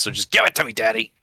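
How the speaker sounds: lightly played pushy child; demanding kid. Kid pestering Daddy for his card, demanding. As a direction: plead for it like an impatient kid.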